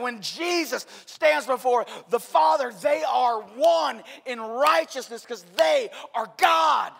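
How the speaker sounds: loud, high, ringing sermon voice; full-force preaching. Acted. Pastor preaching to the congregation about God, loud and ringing. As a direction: proclaim it to the whole church.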